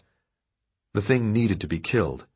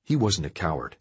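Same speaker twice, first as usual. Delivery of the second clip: usual speaking voice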